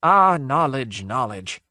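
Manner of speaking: slow, sighing lament, falling away; sad breathlessness. A narrator's reading. Ghost muses on the seekers' wish for knowledge, sad sighing. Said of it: "came the sad breathlessness".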